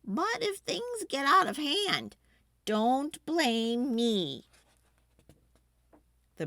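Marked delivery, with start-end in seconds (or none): 0.0-4.5 Ma warning. A narrator's reading. Ma warning in read story.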